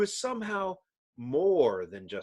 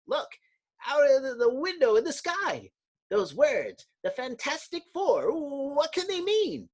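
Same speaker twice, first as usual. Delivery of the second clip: high, excited voice; startled clerk; 12 semitones higher than usual. Acted. Clerk seeing the words in the sky, high and amazed. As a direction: point and gasp.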